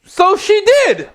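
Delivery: high-pitched exclamation of disbelief; incredulous punchline. Real, not acted. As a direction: land it as an absurd reveal, voice soaring.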